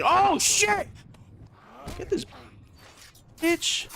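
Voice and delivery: startled high yelp; scared horror game streamer. Real, not acted. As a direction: jump scared, squeal it out. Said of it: "did not just jump scare me".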